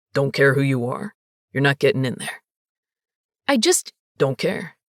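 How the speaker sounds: low, dismissive bouncer. A narrator's reading. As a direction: brush her off, low and bored.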